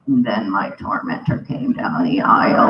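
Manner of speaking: low, menacing mock-dramatic narration; mock ominous. Telling audience of the tormentor's arrival, mock ominous. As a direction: drop low for the villain's entrance. Ominous villain entrance in story.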